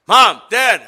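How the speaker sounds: put-on a tired kid pestering his parents; whiny child. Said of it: "can you imagine the children?".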